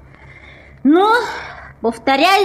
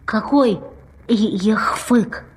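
puzzled whisper; 5 semitones lower, 3 dB quieter than usual. Acted. Puzzled repeat.

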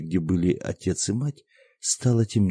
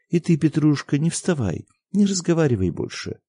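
mother hushing; 8 semitones higher than usual. A narrator's reading.

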